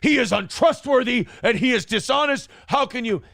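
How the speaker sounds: hammed-up warning against a liar; mock indignation. Nick warning Kayla about Aaron, mock indignant. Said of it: "Nick uses this to tell Kayla".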